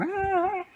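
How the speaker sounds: very high shrill scream; child's scream. Acted. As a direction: scream while kicking in the air. Kid lifted into air.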